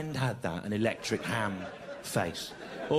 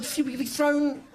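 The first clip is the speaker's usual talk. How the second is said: laughing disbelief; 9 semitones higher, 3 dB louder than usual. Retold or imitated. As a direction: drag out the disbelief, high. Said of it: "I said".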